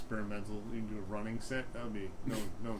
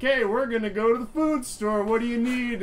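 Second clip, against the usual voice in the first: much higher, louder, cheery voice; improv scene partner; 12 semitones higher, 10 dB louder than usual. Retold or imitated. An improv partner offering to shop, high cheery voice. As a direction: play a bright eager scene partner. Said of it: "then someone says".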